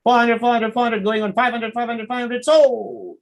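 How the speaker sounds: rapid-fire, high-pitched auction chant; high auctioneer patter, driving to the sale. Shown as a demonstration. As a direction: rattle off bids like an auctioneer. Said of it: "the people who conduct auctions".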